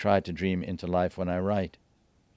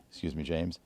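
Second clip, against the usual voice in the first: ordinary delivery, nothing marked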